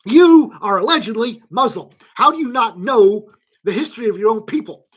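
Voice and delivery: loud indignant rant. Real, not acted. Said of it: "we've been ranting".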